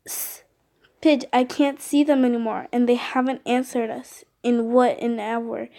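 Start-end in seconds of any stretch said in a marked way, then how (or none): none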